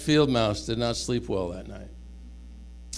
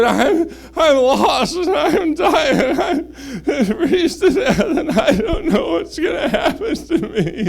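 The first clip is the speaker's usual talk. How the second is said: very high, slow squeaky mouse, laughing through it; a squeaky little mouse, slow and high, with laughter; tiny mouse voice; 13 semitones higher, 7 dB louder than usual. Acted. Lost freezing mouse speaks.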